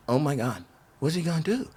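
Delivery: hushed, stunned aside to himself; awed hush. Retold or imitated. Watching a friend race at the ramp, hushed awe.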